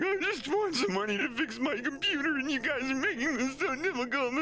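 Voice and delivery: high, whiny mock sob; mock crying lady. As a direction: whine it like a weepy customer. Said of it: "the lady's crying on the phone".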